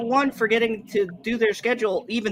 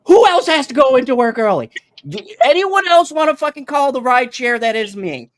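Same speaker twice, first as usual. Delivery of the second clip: louder, higher mock-offer; sarcastic loud rant; 7 semitones higher, 7 dB louder than usual. Real, not acted. Dad sarcastically offering rides to everyone, loud. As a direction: shout the offer like a fed-up driver.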